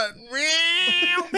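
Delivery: high helium-like squeal of a gunshot; badly faked gun noise, drawn out; imitated gunfire. Shown as a demonstration. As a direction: yowl it like a trodden-on cat. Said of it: "for gun, gunfire".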